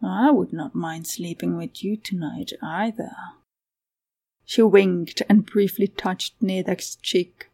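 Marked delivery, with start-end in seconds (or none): 0.0-3.1 soft, breath-filled seductive murmur; hushed, suggestive come-on; flirtatious purr. A narrator's reading. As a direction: purr it softly, teasing. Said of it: "she purred".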